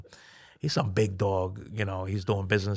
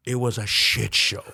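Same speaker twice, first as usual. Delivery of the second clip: ordinary delivery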